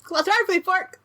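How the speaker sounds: put-on high-pitched excited kid babble; child talking. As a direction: blurt it like an amazed little kid. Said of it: "child talking".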